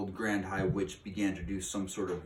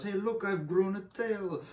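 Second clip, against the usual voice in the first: put-on much higher squeaky girl's cry; girl yelling; 13 semitones higher than usual. A girl finds she has a tail, high mock yell. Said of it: "She yells".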